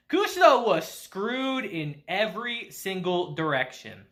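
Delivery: normal talking voice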